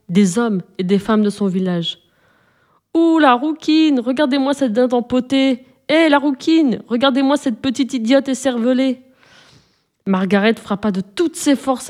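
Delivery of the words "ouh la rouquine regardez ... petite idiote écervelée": mocking playground jeer, pitched high; mocking taunts. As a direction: taunt her like village kids calling names. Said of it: "reconnut les voix des enfants".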